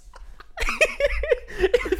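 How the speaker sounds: a big laugh breaking out while telling it; high laugh. Real, not acted. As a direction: cackle at the memory. Laughing at memory.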